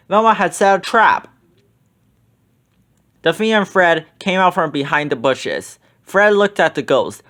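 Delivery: plain reading voice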